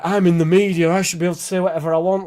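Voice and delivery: high, hurried mock protest; mock indignant whining. Podcaster mocking entitled media people, mock indignant. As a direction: plead as a put-upon crybaby, hammed-up. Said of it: "they cry wolf and they go like".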